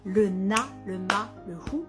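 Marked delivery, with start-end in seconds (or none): none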